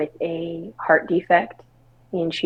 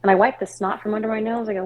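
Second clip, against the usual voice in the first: ordinary talk, nothing marked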